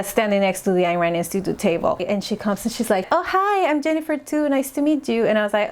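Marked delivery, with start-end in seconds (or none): 3.1-5.3 high chirpy mock greeting; mimicking a sweet greeting. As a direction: imitate her overly sweet hello. Imitating Grossman greeting her.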